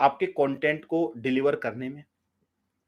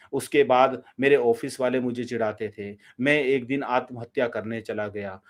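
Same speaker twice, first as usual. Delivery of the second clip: flat, lower, unchanging drone; monotone delivery; 5 semitones lower than usual. Shown as a demonstration. Teacher demonstrating the boring flat tone to avoid, lower monotone. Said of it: "अगर हम ऐसे ही बोलते जाएंगे".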